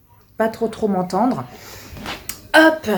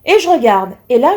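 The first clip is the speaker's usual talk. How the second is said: ordinary talk, nothing marked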